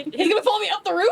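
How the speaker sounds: high, loud, fast disbelief; baffled protest; incredulous. Real, not acted. Player protesting the GM's ruling, high and fast.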